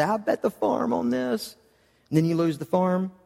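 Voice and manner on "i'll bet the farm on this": a light mock boast, pitched higher; higher-pitched mock bravado; overconfident boast. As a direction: toss it off like a cocky gambler sure of his bet. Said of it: "you're willing to say".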